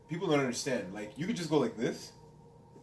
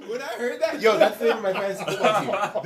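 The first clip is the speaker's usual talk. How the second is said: high, laughing through the words; can't stop laughing while speaking; 9 semitones higher, 7 dB louder than usual. Real, not acted. Laughing while telling the story.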